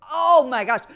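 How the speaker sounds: loud disbelieving gasp of words; mock exasperation. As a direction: throw it out in disbelief. Retold shock at hungover friend.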